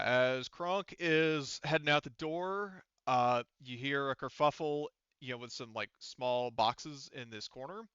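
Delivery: storyteller voice rising into suspense; game master's scene setting. Acted. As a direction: set the scene like something's about to jump out. GM narrates a sudden threat.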